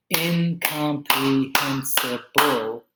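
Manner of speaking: incomprehensible drawn out slowly beat by beat; slow syllable clapping. Shown as a demonstration. Teacher claps out incomprehensible slowly for students. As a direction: break incomprehensible into slow, separate beats. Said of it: "clapping syllables demo".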